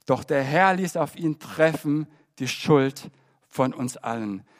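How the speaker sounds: ordinary delivery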